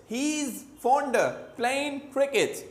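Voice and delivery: slow, spaced British-style heez, fonda, playin' cricket; British connected speech. Shown as a demonstration. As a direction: demonstrate the British reductions slowly, chunk by chunk. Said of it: "मगर वो क्या बोलेंगे". (British अंदाज़ में धीरे-धीरे 'हीज़, फ़ॉन्डा, प्लेइन')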